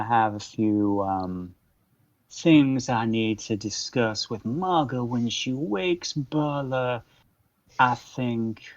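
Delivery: slow, measured Southern-tinged courtesy; polite cartel leader. Acted. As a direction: take your time, formally polite, pausing before key words. Game master voices Matthias.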